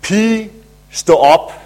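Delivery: loud call, stå op! ringing out; shouted command. Retold or imitated. Jesus calling the dead girl to rise, a loud command. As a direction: call her up from death. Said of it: "han råber".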